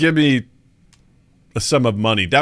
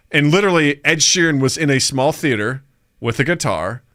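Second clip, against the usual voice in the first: ordinary talk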